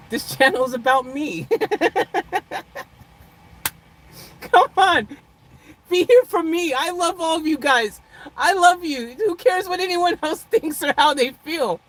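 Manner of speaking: mock self-centred. Vlogger teasing her live chat, mock self-centred. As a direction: ham up being all about yourself. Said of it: "in the most comical way possible".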